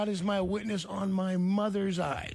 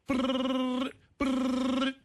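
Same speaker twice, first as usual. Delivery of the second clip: long high trilled brrr; imitated flicker noise; 7 semitones higher than usual. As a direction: roll a buzzing burst, then repeat it. Imitates lights flicking at him.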